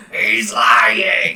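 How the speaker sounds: squawking parrot yell; loud, harsh rasping shout; Iago. Acted. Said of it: "Iago just yelling over his shoulder".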